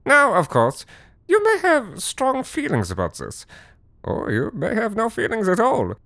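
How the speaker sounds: high, put-on showman voice of a grumpy Halloween host; eccentric German host. Reluctant Halloween host addressing the audience in a high put-on voice. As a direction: play the reluctant foreign host, camping it up.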